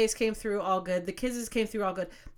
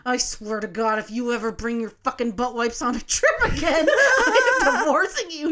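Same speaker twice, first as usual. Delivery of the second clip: laughing mock threat; 5 dB louder than usual. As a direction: threaten him jokingly while laughing.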